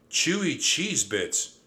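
high, slow cheering exclamation; excited shout. A narrator's reading. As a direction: cheer it out, about to dance. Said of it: "shouted Thea".

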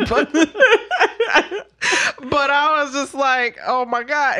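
talking through giggles; can't stop chuckling; real laughter; laughing. Real, not acted. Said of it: "I had to laugh, giggle, chuckle".

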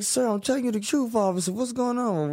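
high, fast, frantic plea; desperate pleading. Retold or imitated. Arrested by the officer, pleading frantically in a high voice. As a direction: beg him to believe you.

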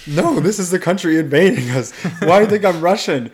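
loud, high indignant protest; acted exasperation. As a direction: protest as the wrongly labelled woman. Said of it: "she's tired that whenever she meets people".

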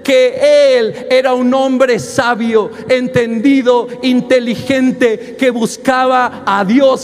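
preacher's fervent, drawn-out delivery; preaching at full force. Acted. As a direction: build it up like a pulpit climax. Rising sermon over music.

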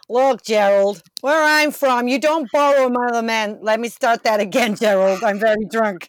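loud, slurred lumberjack bellowing at Gerald; drunken lumberjack shout. Acted. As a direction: yell it like a drunk logger.

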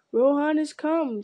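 slow, loud high cry. A narrator's reading. Men shouting to each other, slow loud cry. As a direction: call the news to the others. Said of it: "crying one to another".

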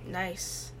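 mock grumble, dropped quiet; Han Solo grumble. Han Solo staring on walking in, quiet grumble. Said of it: "he'd grumble in his little voice".

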